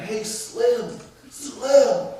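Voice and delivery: high, rising call to a man walking away; calling after someone. Retold or imitated. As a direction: call out the name to stop him. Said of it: "called after the man".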